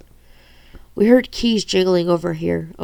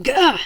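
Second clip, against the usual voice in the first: pained scream; 8 semitones higher than usual. A narrator's reading. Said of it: "Dishon screamed".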